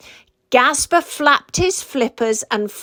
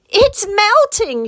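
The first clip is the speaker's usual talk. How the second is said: higher and slow, frightened cry; shrieked; 10 semitones higher, 4 dB louder than usual. A narrator's reading. Penguin captain to his friend on melting ice, frightened shriek. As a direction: alarm as the ice goes. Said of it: "shrieked Gasper".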